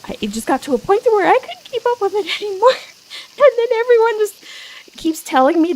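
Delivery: high and fast, laughing; excited rush. Real, not acted. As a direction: tumble the words out high.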